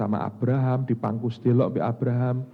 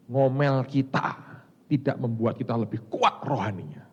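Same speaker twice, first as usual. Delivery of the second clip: normal talking voice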